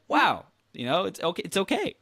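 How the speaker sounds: soothing self-talk. Shown as a demonstration. Coaching a listener through contrast, high gentle reassurance. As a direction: coax yourself gently that it's fine. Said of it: "let's take a step back and be like".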